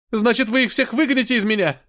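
high, loud desperate cry; shouting. A narrator's reading. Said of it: "Билли снова закричал".

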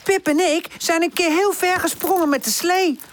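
high-pitched excited call; high, lively character voice calling out. A narrator's reading. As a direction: call it down to someone below. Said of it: "roept Woezel naar beneden".

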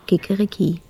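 a light, soft mock rooster crow. A shopkeeper's tape plays a rooster, soft crow. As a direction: crow it like a tape-recorded cockerel. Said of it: "lässt ein Tonband krähen".

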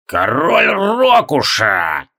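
slow, booming from below; booming call. A narrator's reading. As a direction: thunder it out slowly. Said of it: "прогремело снизу".

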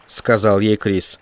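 plain reading voice

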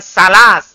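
"salas" with a firm hissed S; S instead of TH. Shown as a demonstration. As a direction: hit "salas" with plain S sounds. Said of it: "bukan salas". ("salas" dengan bunyi S)

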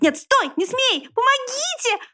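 escalating squeals for help; panicked wailing. A narrator's reading. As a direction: start high and rise into a squealed plea. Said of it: "вопил он".

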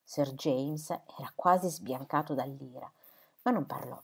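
ordinary delivery

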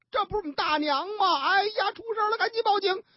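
high, fast, alarmed cry of discovery; shocked son. Acted. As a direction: panic as he recognises the body, voice climbing. Son finds dead aunt.

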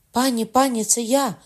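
shouting woman. A narrator's reading. The countess shouts to the queen from the corridor. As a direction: call out desperately through the door. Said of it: "крикнув жіночий голос".

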